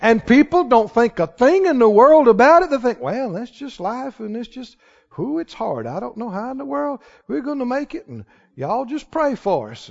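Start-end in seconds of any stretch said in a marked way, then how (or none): 3.0-9.9 much softer mock-weary complaint; half-hearted hopeless murmur; imitating defeatist talk. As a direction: play the resigned churchgoer. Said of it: "you can hear that talk at any church".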